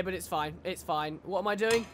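ordinary talk

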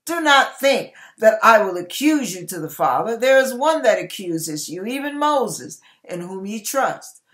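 ordinary talk

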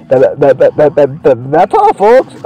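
stuttering cartoon pig sign-off; Oinky Oinky. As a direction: stutter on "that", then lift into the catchphrase. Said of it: "yeah, it's like".

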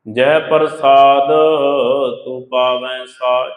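slow, drawn-out recitation of the verse; scripture recitation. Acted. Preacher intoning the Guru's verse to the congregation, slow and reverent. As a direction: intone each word slowly and reverently.

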